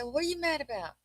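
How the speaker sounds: retold mom asking her kid; questioning a child. Retells questioning her young son.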